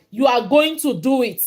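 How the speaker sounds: ordinary delivery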